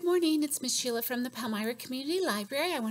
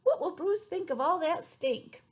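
ordinary delivery

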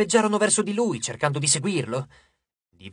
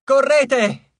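loud, much higher yell; shout to run; 8 semitones higher, 7 dB louder than usual. A narrator's reading. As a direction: scream at them to run. Frank tells friends to flee. (urlo forte e acuto)

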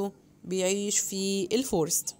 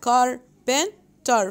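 carpenter drawn out very slowly, car-pen-ter, a little louder; syllable by syllable; 3 semitones higher, 5 dB louder, much slower than usual. Shown as a demonstration. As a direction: stretch carpenter into its three beats for the class. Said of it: "برضو دول three syllables". (carpenter ببطء شديد مقطعًا مقطعًا)

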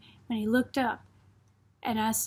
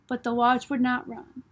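plain reading voice

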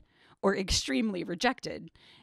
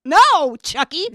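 playful shout; 8 semitones higher, 12 dB louder, slower than usual. Real, not acted. Playful teasing retort.

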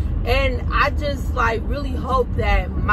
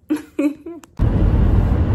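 a burst of real laughter; laughing after saying goodbye; short laugh; 3 semitones higher than usual. Real, not acted. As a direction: laugh it off lightly.

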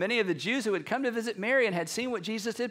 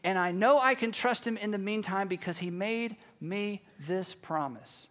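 usual speaking voice

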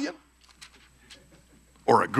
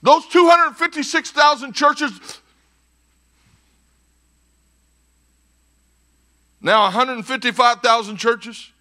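raised, forceful pulpit voice; emphatic preaching; 10 semitones higher, 6 dB louder than usual. Real, not acted. Preacher challenging churches on who they are, emphatic. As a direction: press the point on the congregation.

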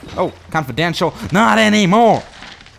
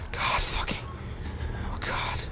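voiceless, trembling breath; hushed panic under the breath; terrified whisper; 14 dB quieter than usual. Real, not acted. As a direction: whisper so nothing hears you. Hiding from a monster.

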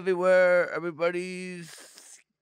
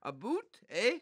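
put-on Canadian accent; 3 semitones higher, 6 dB quieter than usual. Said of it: "what's your best Canadian accent".

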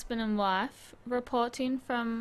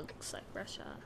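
whispered aside; 14 dB quieter than usual. Real, not acted. Unvoiced aside.

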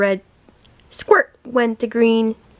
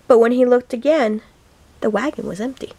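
plain reading voice

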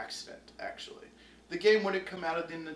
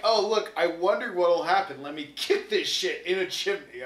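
louder, mocking ditzy-girl voice; mock Alice; 3 semitones higher, 10 dB louder than usual. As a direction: sneer it like a careless schoolgirl. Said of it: "She's like".

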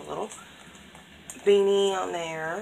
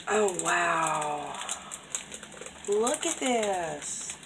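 ordinary talk

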